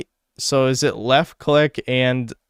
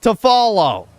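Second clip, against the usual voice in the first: raging rant; 10 semitones higher than usual. Real, not acted. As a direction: lose it completely at the game. Gamer raging after failed run.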